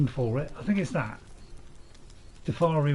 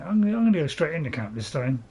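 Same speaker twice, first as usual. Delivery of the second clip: ordinary talk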